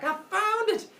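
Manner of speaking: very high triumphant squeak; little-boy voice pitched right up; excited child. Acted. Boy arriving at his father's palace, high excited squeal.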